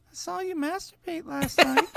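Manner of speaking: very high, put-on female dog voice; creepy girl dog. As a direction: squeak it out as a creepy little pet. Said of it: "obviously a girl, so she'd be like".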